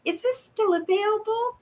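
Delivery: a hint of a chirpy buyer; mock buyer's voice. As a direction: voice the message as a keen stranger. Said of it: "I get a message saying".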